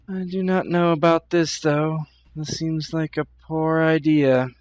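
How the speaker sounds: lightly voiced, formal character with misgivings; deliberate, hesitant delivery that slows toward the end; doubtful Fatima. Fatima doubting a traveler's promise of safety, hesitant.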